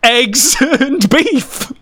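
very slow, high, giggling through it; laughing. Real, not acted. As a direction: laugh out the translation. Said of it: "which just means".

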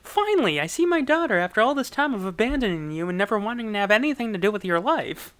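high, melodramatic mock reunion speech; hammed-up father. Estranged dad finally seeing his daughter, hammed-up. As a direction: gush with overblown fatherly emotion.

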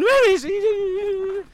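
squeaky, slow, put-on character voice; a comic searcher calling out in a high voice. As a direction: stretch it out in a high silly voice. Improvised skit character searching.

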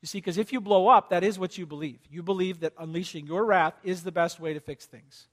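normal talking voice

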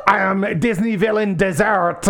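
hammed-up French accent, slow and laughing; mock French accent. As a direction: caricature a French villain's accent, giggling. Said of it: "a caricature of a French accent".

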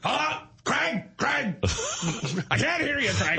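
high, confused phone holler; flustered dad hollering. Retold or imitated. Dad answers son's call too soon, high mock holler. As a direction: imitate a dad calling out into a car phone. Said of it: "it'll be like".

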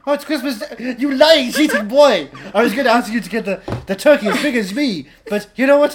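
loud, high, cackling menace; crazed laughing mother. Acted. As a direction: scream it with manic glee. Mother turning cannibal, laughing loudly.

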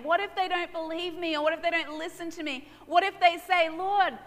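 high, loud anxious protest; protesting Moses. Acted. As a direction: plead your excuses to God. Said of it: "Moses protested again".

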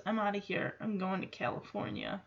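usual speaking voice

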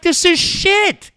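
slow, high, disgusted drawl; fed-up disgust. Retold or imitated. Listener fed up with bored radio hosts, slow disgusted drawl. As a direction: spit out your contempt slowly. Mocking tuned-out hosts' attitude.

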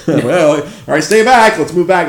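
high, loud crowd-control call; riot police shouting orders. Retold or imitated. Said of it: "floats were making their way through".

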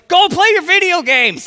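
high, loud dismissive shout; snapping at the kid. Acted. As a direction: shoo the kid away sharply. Parent snapping at child.

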